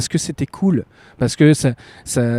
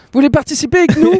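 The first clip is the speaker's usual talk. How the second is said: calling out; 14 semitones higher, much faster than usual. Pretend. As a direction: call out to the crowd. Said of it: "de lever la main très fort et de faire".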